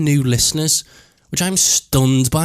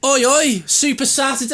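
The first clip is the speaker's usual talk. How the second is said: lads cheering; 11 semitones higher than usual. Retold or imitated.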